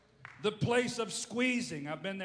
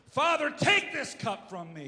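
said straight and a little louder; a blunt, raised plea; plain blunt plea; 5 semitones higher, 7 dB louder than usual. Retold or imitated. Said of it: "He just says it like it is".

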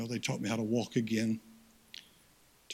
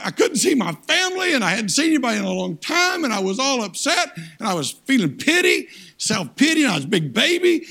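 loud, high, anguished preacher's cry; full-force preaching; 10 semitones higher, 11 dB louder than usual. Acted. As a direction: wail out the hospital despair. Preaching his hospital story.

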